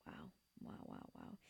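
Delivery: breathy whispered wows to herself. Real, not acted. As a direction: whisper it, worn out and amazed.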